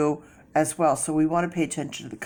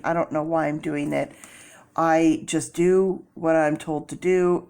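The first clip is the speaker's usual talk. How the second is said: ordinary talk, nothing marked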